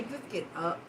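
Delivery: quiet, exasperated mutter; soft and fed up, wanting it over. Retold or imitated. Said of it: "I was about to say".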